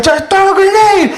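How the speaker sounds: high, hollered command; insistent battlefield yell. Acted. Soldier pressing his sergeant to act, insistent yell. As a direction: yell it urgently, voice shooting up. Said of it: "he's like".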